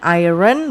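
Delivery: "iron" misread as "AI-ren"; wrong pronunciation. Shown as a demonstration. Teacher demonstrating the mistake to pupils, "iron" mispronounced "AI-ren". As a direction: say "iron" as spelt, "eye-ren". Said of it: "bukan iron".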